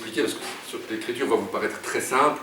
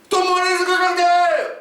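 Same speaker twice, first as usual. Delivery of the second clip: high, slow, garbled shout; 8 dB louder than usual. A narrator's reading. Oumar bursts into the post office, shouting. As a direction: bellow it high and stretched out. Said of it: "se relève et crie".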